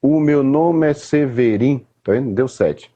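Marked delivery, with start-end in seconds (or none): 0.0-1.8 slow, syllable by syllable on "batatinha quando na"; counting syllables. Shown as a demonstration. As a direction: tap out each syllable of "batatinha quando na" slowly. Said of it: "ó". (contando as sílabas devagar)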